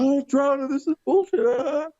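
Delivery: high-pitched panicked wail, acted out; freaking out. Rhino on the cracking ice, freaking out. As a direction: imitate a panicked man wailing. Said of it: "he freaks out".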